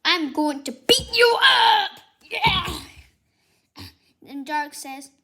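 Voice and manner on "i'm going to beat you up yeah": high, loud yell of a threat; teacher shouting a threat; angry shout. Acted. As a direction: scream it at him. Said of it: "So then Missus Strawn says".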